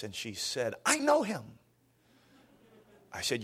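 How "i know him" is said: high, surprised waitress voice; a lightly voiced waitress, pitched high. Pastor imitates the waitress recognizing the name, high and surprised.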